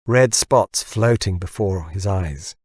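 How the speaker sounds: plain reading voice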